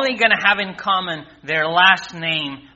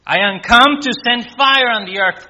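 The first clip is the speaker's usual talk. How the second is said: loud, higher-pitched proclamation of scripture; emphatic wake-up call; 5 semitones higher, 8 dB louder than usual. Acted. Preacher repeating the fire verse to wake people up, emphatic and loud. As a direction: ring it out loudly to rouse everyone.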